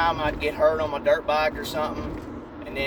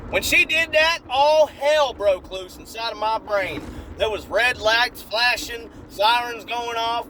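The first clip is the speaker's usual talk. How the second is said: normal talking voice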